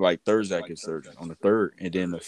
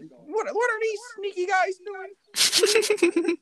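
very high, slow, squeaky voice; squeaky mock voice; 5 dB louder, much slower than usual. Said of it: "They're going".

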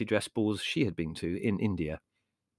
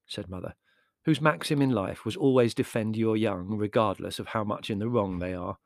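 ordinary delivery, nothing marked

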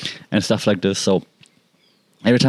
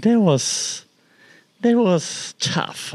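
voice breaking; 11 semitones higher, much slower than usual. Real, not acted. Recalling the cat's death.

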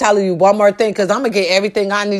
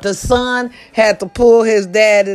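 normal talking voice

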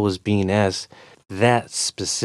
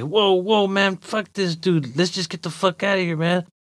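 urgent pleading; 10 semitones higher than usual. Acted. Walker pulls his friend away from a fight, urgent. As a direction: talk a friend out of it.

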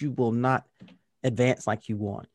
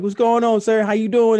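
higher, louder eager greeting; eager room members; 8 semitones higher, 7 dB louder than usual. Retold or imitated. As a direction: greet the dad like everyone's excited. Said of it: "everybody's like".

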